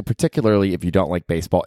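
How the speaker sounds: ordinary talk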